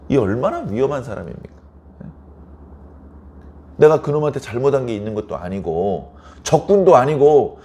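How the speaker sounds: normal talking voice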